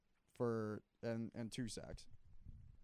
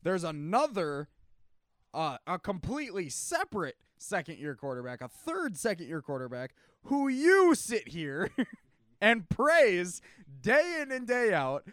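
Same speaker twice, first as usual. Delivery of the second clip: heated excited; 7 semitones higher, 10 dB louder than usual. Real, not acted. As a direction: build to a loud rant. Heated fan argument, teasing.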